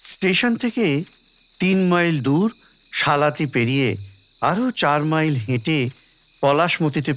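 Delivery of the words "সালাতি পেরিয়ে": ordinary delivery, nothing marked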